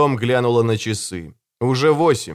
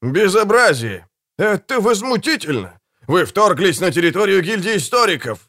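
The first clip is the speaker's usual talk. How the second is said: high, put-on voice of outrage; outraged man crying out, pitched high; indignant protest; 7 semitones higher than usual. A narrator's reading. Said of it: "отчётливо раздался голос Чадли Помроя".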